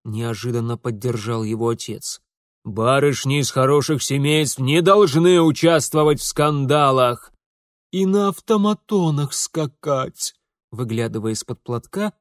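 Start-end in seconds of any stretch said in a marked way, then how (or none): 2.6-7.3 loud, indignant fatherly lecture; stern reprimand. Acted. Father lectures daughters angrily. 7.9-10.3 high, slow plaintive interjection; reproachful mother. Acted. Moaning mother chimes in reproachfully.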